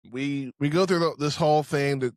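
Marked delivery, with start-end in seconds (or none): none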